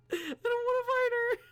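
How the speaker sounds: very high, loud hammed-up wail; fake-sob it in a high wail; mock crying. Mock crying over fighting Dawn.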